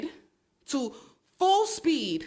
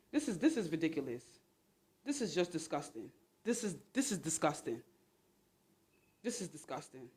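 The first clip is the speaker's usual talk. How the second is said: muttered disgust; 7 semitones lower, 10 dB quieter than usual. Real, not acted. Disgusted by the smell.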